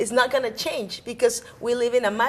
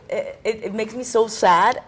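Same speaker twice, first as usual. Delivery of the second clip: normal talking voice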